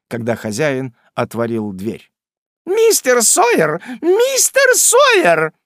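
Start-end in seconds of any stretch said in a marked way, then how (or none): 2.6-3.8 high, slow shrill shriek up the stairs; визгливый оклик хозяйки. Acted. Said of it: "визжал женский голос". 4.0-5.5 even higher, slower, louder screech; ещё более высокий визг. Acted. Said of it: "визжал женский голос".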